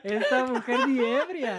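put-on slow, laughing old-man voice. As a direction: drawl it like a creaky old man. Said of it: "el viejito dice".

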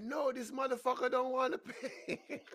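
high, loud yell cracking into laughter; hammed-up indignant holler, laughing at the end; outraged mock yell. Acted attendant complaining, breaks into laughter.

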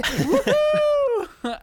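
very high, loud laughing whoop; excited cheer. Retold or imitated. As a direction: whoop it out in delight. Cheering at hoped-for game.

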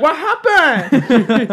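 high, loud, drawn-out mock bewilderment; mock protest. Customer being thrown out protests, mock bewildered. Customer feigns innocence when ejected.

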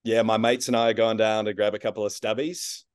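an American's attempt at Australian; broad Aussie "mites" for mates, rising at the end; Australian accent. Shown as a demonstration. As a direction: put on a casual Australian accent. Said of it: "very intrigued to hear your Australian accent".

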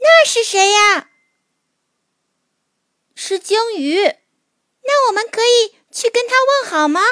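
put-on high, eager child voice; excited small creature asking; little mole voice. A narrator's reading. Mole sees the whale.

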